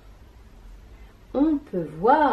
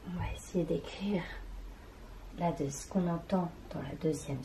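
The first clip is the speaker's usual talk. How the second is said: chuchoté; 3 semitones lower, 9 dB quieter, faster than usual. Real, not acted. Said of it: "chuchote en écrivant".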